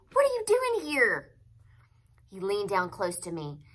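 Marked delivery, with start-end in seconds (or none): 0.1-1.4 very high, loud startled question; astonished shout, pitched way up; hollered. A narrator's reading. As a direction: holler it in disbelief. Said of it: "he hollered".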